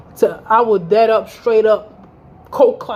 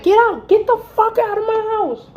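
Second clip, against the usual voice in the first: furious shout; 13 semitones higher than usual. Retold or imitated. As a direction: throw him out. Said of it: "I was like".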